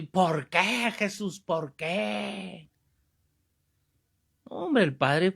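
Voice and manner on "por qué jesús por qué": high, stretched wail that softens toward the end; hammed-up cry to heaven; mock anguished outcry. Man at a retreat interrupts the priest once more, mock anguished outcry. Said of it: "pasaba otro ratito y".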